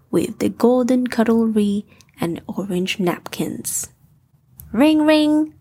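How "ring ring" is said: chiming call that the food is ready; dinner bell. Acted. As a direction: sing out ring ring high and loud.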